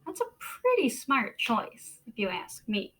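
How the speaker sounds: ordinary delivery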